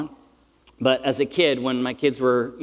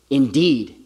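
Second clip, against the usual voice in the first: "Indeed" set alone, louder, falling firmly; emphatic; 5 dB louder than usual. Real, not acted. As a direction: hit "Indeed" hard as one emphatic word. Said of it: "It's emphatic".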